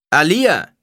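loud, high shout of a name; calling out. A narrator's reading. Man near the front hails Alia, calling out. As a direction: shout to get her attention. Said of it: "rief ein Mann".

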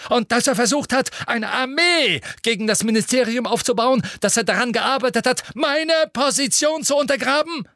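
high, loud, fast triumphant questions; gloating. A narrator's reading. Minister gleefully checks the clerk's record, loud. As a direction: press on, barely containing glee.

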